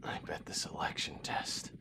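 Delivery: wounded, barely voiced; faint injured whisper; weak whisper. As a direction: breathe the words out in pain. Wounded soldier talking weakly.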